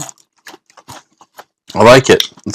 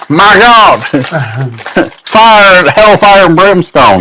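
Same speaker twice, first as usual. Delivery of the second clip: high laughing outburst; laughing exclamation; 7 semitones higher, slower than usual. Real, not acted. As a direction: cry out between laughs. Laughing at colon joke.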